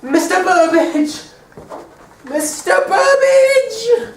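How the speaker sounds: high, loud and slow, stretched stage call; big hammed-up stage shout; melodramatic calling. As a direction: call out for him big and drawn out. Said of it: "let me see how you're gonna come in".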